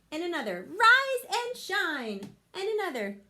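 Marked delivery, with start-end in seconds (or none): 0.7-2.4 slow, high, loud wake-up call. Acted. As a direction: sing it out to wake them. Camilla waking leaves.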